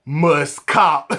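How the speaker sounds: two spaced, punchy words; emphatic joint verdict. Retold or imitated. Two friends looking at each other, emphatic verdict. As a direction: re-enact a shared instant decision. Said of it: "simultaneously said".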